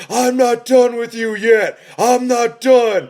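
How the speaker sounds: loud, high wrestler's war cry; roaring, high-pitched threat imitation; imitated screaming. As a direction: mock-scream it at full volume, drawing out the final word. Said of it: "will just keep screaming".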